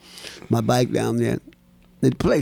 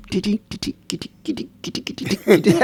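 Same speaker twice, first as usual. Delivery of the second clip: tapping sounds; 8 semitones higher, faster than usual. Retold or imitated. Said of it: "I sit there and I go".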